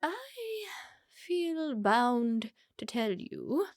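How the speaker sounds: drawn-out high start, gliding down slowly; haughty condescension. Acted. Mother addressing an unwelcome suitor, haughty and slow. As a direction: stretch the words with grand disdain.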